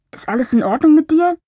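voice from far away. A narrator's reading. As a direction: shout faintly across a great distance. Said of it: "drang die Stimme wie aus weiter Ferne".

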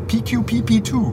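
slow, lightly put-on English spelling; mock English phone voice. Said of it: "what is your email address".

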